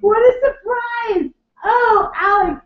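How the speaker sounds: high, slow, exaggerated gasp of surprise; mock surprise. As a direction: act overly astonished, drawn out. Mock surprise at announced bat mitzvah.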